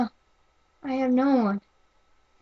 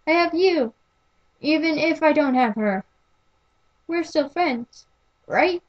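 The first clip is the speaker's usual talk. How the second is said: higher and rising with each question; growing eager hope, climbing pitch; hopeful; 5 semitones higher than usual. Acted. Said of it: "sound hopeful now".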